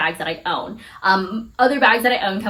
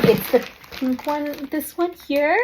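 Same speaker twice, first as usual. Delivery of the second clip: ordinary talk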